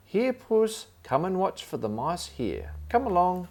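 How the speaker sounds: bright high call to a pet. Acted. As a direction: call the cat from across the room. Calling the cat.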